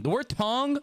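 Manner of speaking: "tongue" drawled with a Southern twang, pitch up; "tongue" in a light Southern twang; twangy tongue. Shown as a demonstration. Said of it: "when I say the word tongue".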